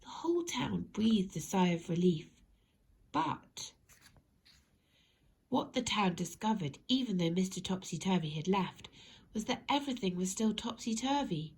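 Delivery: ordinary delivery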